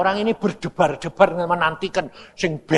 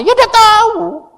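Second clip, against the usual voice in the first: loud, drawn-out high yelp; slow, squealed mock exasperation; clueless outburst; 9 dB louder, slower than usual. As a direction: stretch out the high shouted answer. Repeats clueless answer.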